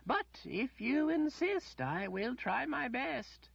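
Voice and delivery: the wizard pretending frailty to the lady; feigned frail old man. A narrator's reading. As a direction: play up your shaky hands.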